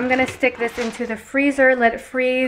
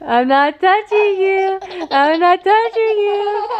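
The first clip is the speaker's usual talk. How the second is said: high, laughing sing-song tease; 8 semitones higher than usual. Real, not acted. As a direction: giggle through a playful taunt. Teasing game with kids.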